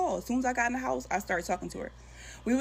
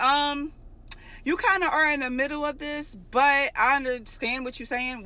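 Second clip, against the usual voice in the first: pointed sarcasm; 6 semitones higher, 4 dB louder than usual. Retold or imitated. Pointed reply to girlfriend.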